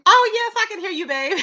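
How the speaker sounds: delighted reply; eager. Real, not acted. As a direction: welcome the caller brightly. Greeting caller.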